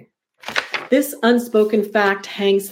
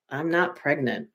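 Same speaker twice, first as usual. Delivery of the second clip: soft, low-key denial; quietly; 3 semitones lower, 7 dB quieter than usual. A narrator's reading. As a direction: say it quietly, barely audible. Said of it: "quietly says".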